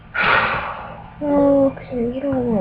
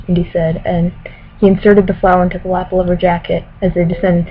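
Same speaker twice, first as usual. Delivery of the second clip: louder, careful novel reading voice; story narration; 5 dB louder than usual. Acted. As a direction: read it like an audiobook. Reads novel passage aloud.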